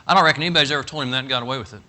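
ordinary talk, nothing marked

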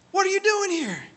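very high-pitched, incredulous squeal of a question; astonished greeting. Retold or imitated. Dad spots long-lost young man at church, astonished. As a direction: imitate a startled double-take in a high voice.

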